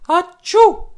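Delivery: high, loud achoo; loud sneeze. A narrator's reading. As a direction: let the sneeze burst out. Woman sneezes.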